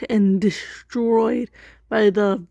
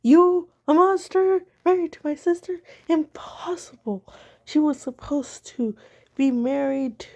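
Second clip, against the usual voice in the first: much higher, shocked outcry; horrified disbelief; 5 semitones higher than usual. Acted. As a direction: gasp it out, appalled. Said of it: "has a completely horrified face".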